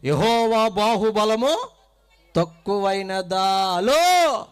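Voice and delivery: slow, loud scripture emphasis; slow emphatic verse. Acted. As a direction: preach the verse slowly with weight. Preacher stresses verse.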